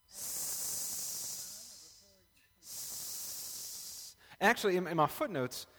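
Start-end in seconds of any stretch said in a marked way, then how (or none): 0.1-4.2 a soft unvoiced hiss like a snake. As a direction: hiss the s like a serpent. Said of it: "I imagine it was something like".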